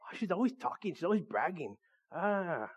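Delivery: soft mock grumbling; annoyed. Preacher voicing someone fed up with a braggart, quietly annoyed. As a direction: complain under your voice, acting fed up. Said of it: "They get on your nerves".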